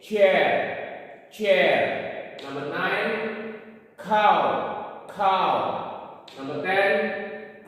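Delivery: teacher modelling vocabulary for the class; vocabulary drill. Shown as a demonstration. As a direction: call out each word clearly like a teacher. Teacher leads vocabulary drill aloud.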